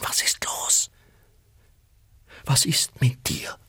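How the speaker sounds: hushed worried whisper; a wife's frightened whisper in the dawn. A narrator's reading. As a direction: whisper it, afraid to wake anyone. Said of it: "flüsterte sie".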